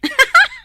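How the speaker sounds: a sharp high burst of laughter; sudden laugh. Acted. Friend laughs at hearing he's a rival, bursting laugh. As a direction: laugh out loud at the news.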